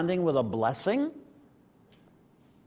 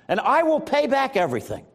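higher, lightly pleading promise; mild begging; 6 semitones higher than usual. A narrator's reading. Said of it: "he begged".

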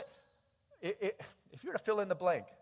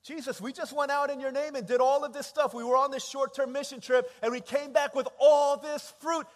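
raised, loud, building plea; indignant protest; 5 semitones higher, 8 dB louder than usual. Acted. Disciples back from mission trip protesting to Jesus, loudly. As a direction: argue it at high volume, peaking at the end.

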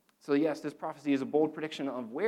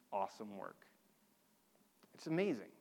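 ordinary talk

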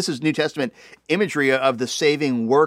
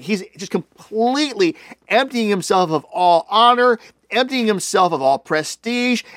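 normal talking voice

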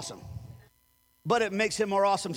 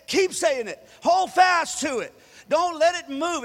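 rising preacher's exhortation, louder toward the end; preaching; 3 semitones higher, 3 dB louder than usual. Acted. Pastor urging the congregation, building in force. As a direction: press the point home from the pulpit.

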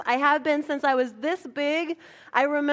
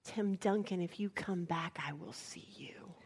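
softer and softer down to a whisper; whispered vow; 6 semitones lower, 13 dB quieter than usual. Pretend. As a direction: hush it like a secret promise. Vowing to the missed star.

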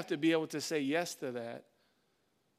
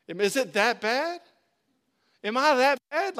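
loud, higher, incredulous questioning; mock offended; 5 semitones higher, 7 dB louder than usual. Pastor to doubters of his church plant, mock offended. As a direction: protest loudly as if insulted.

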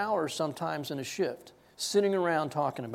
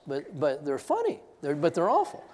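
ordinary delivery

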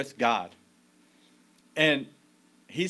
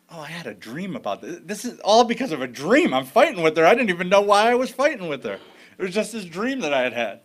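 higher and louder, exasperated at himself; like it finally dawns on you, half frustrated; exasperated realization; 5 semitones higher, 6 dB louder than usual. Retold or imitated. Husband realizing his anger came from a dream, exasperated.

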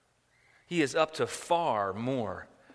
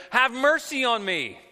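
loud, high pleading cry; 9 semitones higher, 7 dB louder than usual. Retold or imitated. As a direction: call it out to him, begging for mercy. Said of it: "She cries out".

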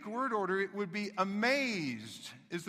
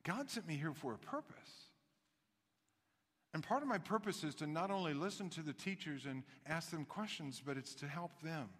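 gentle Jesus; 4 semitones lower, 9 dB quieter than usual. Retold or imitated. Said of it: "He's saying".

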